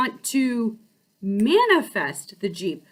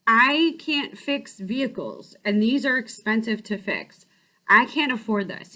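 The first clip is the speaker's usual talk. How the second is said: ordinary delivery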